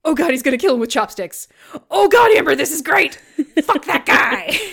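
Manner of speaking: high, fast, delighted cheering for the carnage; root for the kill like a thrilled fan; giddy bloodthirsty glee, voice jumping up; gleeful cheering. Retold or imitated. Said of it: "Megan says".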